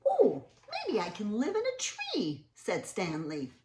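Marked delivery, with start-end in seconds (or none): 0.1-2.4 high hopeful character voice; Stanley's voice. Acted. Reading to kids, hopeful Stanley wondering where to live. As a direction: lift the pitch for hopeful Stanley.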